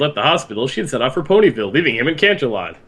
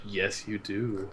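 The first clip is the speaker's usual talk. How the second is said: much softer, slow, low menacing Yes, you do; 5 semitones lower, 15 dB quieter, slower than usual. Retold or imitated. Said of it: "he's like".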